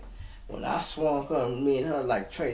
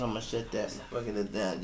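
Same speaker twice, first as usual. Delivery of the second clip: ordinary delivery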